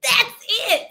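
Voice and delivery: high, breathy squeal of delight; excited ooh. Retold or imitated. Retelling the sister's thrilled reaction, high excited squeal.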